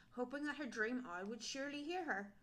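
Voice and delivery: usual speaking voice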